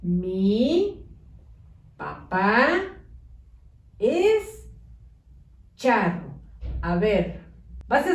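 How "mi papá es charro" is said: each word set apart with pauses; slow dictation, one word at a time; spaced words. Shown as a demonstration. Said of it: "Debe de decir".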